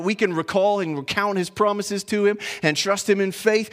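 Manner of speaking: ordinary talk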